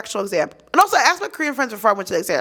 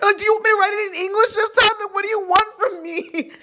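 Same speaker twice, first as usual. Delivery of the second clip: exasperated; 7 semitones higher than usual. Retold or imitated. Exasperated retort to professor.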